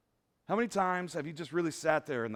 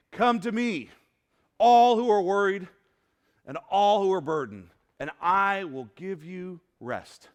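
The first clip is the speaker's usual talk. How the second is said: emphatic scripture reading; 7 dB louder than usual. Acted. Quoting Jesus's invitation with emphasis.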